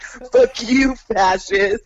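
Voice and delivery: high, loud jeering curse; mock punk yell. Punks cursing the old man as a fascist, loud angry yell. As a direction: spit it at him like a furious punk.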